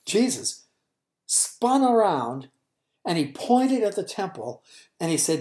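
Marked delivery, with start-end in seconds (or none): none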